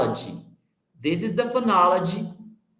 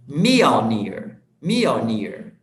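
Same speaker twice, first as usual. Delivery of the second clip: "Mjolnir" peaking at the start, then dropping low; teacher's demo of "Mjolnir" with front stress and falling tune; stress on first syllable; 6 semitones lower, much slower than usual. Shown as a demonstration. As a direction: punch the opening of "Mjolnir". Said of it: "The intonation is in the beginning".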